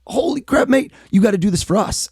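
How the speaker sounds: lightly Australian, high and amazed; a hint of an Aussie boss blurting out surprise; James Gorman impression. Bank CEO amazed by the money trick, light Australian high exclamation. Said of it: "an Australian guy named James Gorman".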